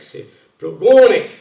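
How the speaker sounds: Plogonec with the stress loud on the middle syllable; right Breton stress. Retold or imitated. Breton friend correcting a place name, middle syllable loud and stressed. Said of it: "l'importance de l'accent tonique".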